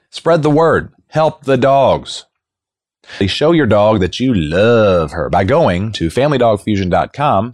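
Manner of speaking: upbeat announcer read over music; podcast host intro. Acted. Host welcoming dog lovers to the episode, announcer style. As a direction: open the show like a radio host.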